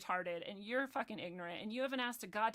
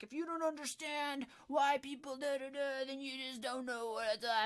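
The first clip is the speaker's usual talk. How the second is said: higher, mock-preachy voice; mocking imitation; 4 semitones higher than usual. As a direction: parrot them in a raised put-on voice. Mocking what people say to her.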